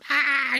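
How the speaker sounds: high mock shriek; mock scream. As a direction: shriek in fake fright.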